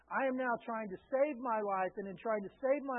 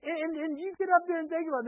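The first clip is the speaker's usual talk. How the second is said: loud, fast, higher mock scolding; imitating angry parent; 7 semitones higher, 6 dB louder, much faster than usual. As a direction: bark it like a fed-up dad sending kids upstairs. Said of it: "I was angry".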